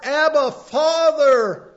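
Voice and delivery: slow, loud, high cry of Abba, Father. Retold or imitated. The Spirit in our heart crying to the Father, slow loud cry. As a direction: call out slowly like a child to its father. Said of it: "cries in our heart".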